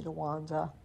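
normal talking voice

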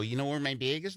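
"bag" said like "beg"; Wisconsin "bag". Retold or imitated. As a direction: ask with the Wisconsin vowel. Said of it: "I go".

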